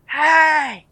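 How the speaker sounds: imitated neighbour hollering, high and drawn out; high mock holler across the yard; calling out. Neighbour calling for the hidden mom, long high holler. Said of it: "calling her name and shit".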